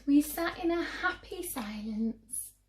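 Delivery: ordinary delivery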